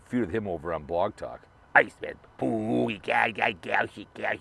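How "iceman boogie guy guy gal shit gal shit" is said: a lightly voiced mock of Sven's ramble; imitating Sven. Recalling old feud rival Sven's talk, lightly mimicked. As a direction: slip halfway into Sven's odd voice. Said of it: "He would talk like that".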